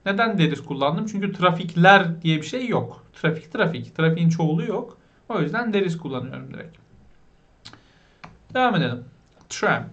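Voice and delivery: usual speaking voice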